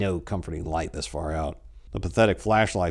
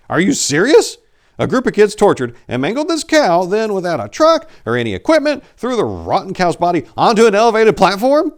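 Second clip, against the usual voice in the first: much higher, louder incredulous outburst; outraged protest; 12 semitones higher, 9 dB louder than usual. A narrator's reading. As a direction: throw the disbelief right in his face. Priest argues with bored cop.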